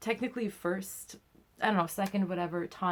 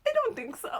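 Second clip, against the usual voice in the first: tiny high-pitched squeak; comically high, strained little reply; retold distressed squeak; 3 dB louder than usual. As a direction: squeak it out as if about to be sick. Retold answer while nearly vomiting.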